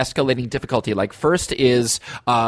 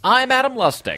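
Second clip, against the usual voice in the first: projected theatrical announcer voice; stage voice; 10 semitones higher than usual. Pretend. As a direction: project it to the back row. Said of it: "Adam's bringing his stage voice".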